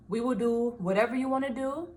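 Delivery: usual speaking voice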